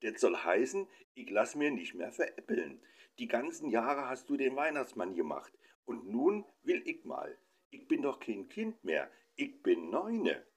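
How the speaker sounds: put-on a nine-year-old insisting he is grown; lightly voiced sulky son; indignant boy. A narrator's reading.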